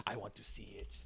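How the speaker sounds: low, soft mock-creepy voice; creep's tagline. Trying out a tagline for the creep, low and soft. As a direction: murmur it as a creep's catchphrase. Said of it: "maybe his tagline should be".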